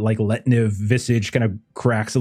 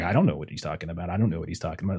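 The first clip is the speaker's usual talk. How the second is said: quick hushed mutter; 4 semitones lower, 7 dB quieter, much faster than usual. Acted. Guards muttering to each other.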